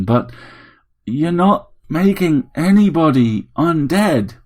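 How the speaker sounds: a grumpy widower caught off guard; startled old man. A narrator's reading. Harry questioning the robot, incredulous.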